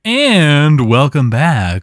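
stretched-out, slow presenter greeting; show-host welcome. Pretend. Host reopens the show after the ad, slow presenter voice. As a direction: draw it out like a presenter.

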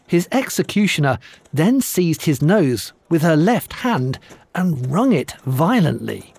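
ordinary delivery

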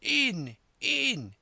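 very high, loud repeated command; frantic, eager high yell; enraged shout. A narrator's reading. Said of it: "His voice sounded louder and higher".

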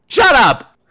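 loud, high burst of irritation; exasperated snap. Acted. Comic fed up with fake cursers, exasperated snap. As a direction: cut them off sharply.

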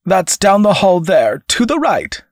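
high-pitched clerk's voice; brusque clerk. A narrator's reading. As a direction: point the way in a high, insistent voice. Same armed clerk gives directions.

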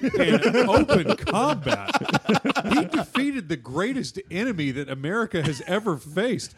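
laughing through grand praise. Real, not acted. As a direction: chuckle while declaring.

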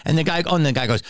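ordinary talk

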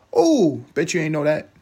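high, loud taunting oh; smug gotcha; gloating. Real, not acted. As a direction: rub it in with a cocky squeal. Gloating over trivia.

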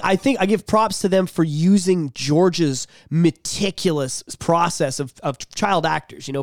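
ordinary talk

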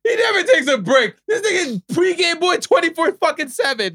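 high shouted protest; shouted callout. Real, not acted. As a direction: yell it across the table. Friend loudly calls out.

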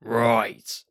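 loud, punchy gangster call; Arthur Shelby. Acted. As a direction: bark one word like a gang boss. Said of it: "Right, that's my Arthur Shelby".

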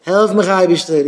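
high, loud plea for help; crying out to God. Acted. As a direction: call up to heaven, imploring. Acted cry to God for help.

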